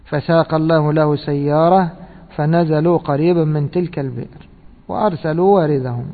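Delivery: ordinary talk, nothing marked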